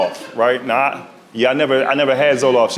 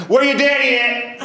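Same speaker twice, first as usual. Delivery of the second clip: high, slow auntie question; old lady voice; 9 semitones higher, slower than usual. Retold or imitated. Voicing old lady doctor.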